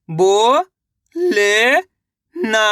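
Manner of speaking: अ, नो, खा drawn out separately, the first two rising; syllable by syllable. Shown as a demonstration. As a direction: sound out अ, नो, खा with long gaps. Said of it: "एक अक्षर का उच्चारण सही से". (अ, नो, खा अलग-अलग खींचकर)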